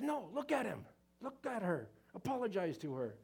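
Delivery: put-on high-pitched, insistent teacher telling off a child; scolding teacher. Teacher ordering Jimmy to look at Susie and apologize, scolding. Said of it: "Teacher goes".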